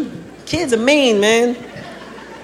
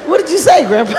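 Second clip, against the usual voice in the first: baffled high question; 7 semitones higher than usual. Acted.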